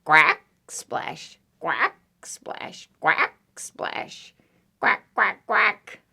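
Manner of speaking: short duck quacks with splashes; squawky little quacks. Acted. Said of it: "And duck went".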